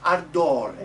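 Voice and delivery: loud "Ardore" ending in closed ay; projected model "Ardore" with narrow final e; bright final E. Shown as a demonstration. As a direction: brighten the last vowel of "Ardore". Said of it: "E at the end is the bright one".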